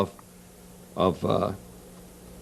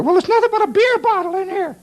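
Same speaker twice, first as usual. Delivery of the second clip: high-pitched holler from inside a car; wife calling back; faster than usual. Acted. Wife inside the car answering her husband, high yelled reply. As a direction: call it out like an exasperated wife. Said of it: "from inside the car, you hear".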